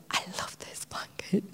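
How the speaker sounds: soft breathy whisper to the Lord. Retold or imitated. Telling the Lord alone about the blanket, whispered. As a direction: whisper it like a private prayer.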